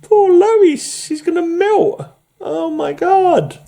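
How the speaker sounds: very high, squeaky put-on dismay; mock pity. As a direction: falsetto lament for the doomed heroine. Mock pity for melting Lois.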